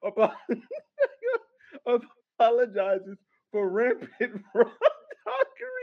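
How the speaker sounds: laughing through the words; cracking up mid-sentence; stifled giggles. Real, not acted. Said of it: "I can't keep a straight face now".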